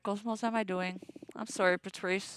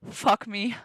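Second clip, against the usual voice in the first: ordinary talk, nothing marked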